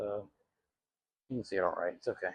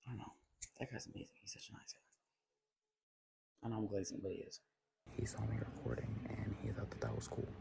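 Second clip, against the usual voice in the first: whispering; 7 dB quieter than usual. Real, not acted. Hushed while the man is nearby.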